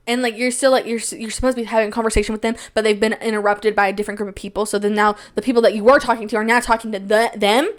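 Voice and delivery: usual speaking voice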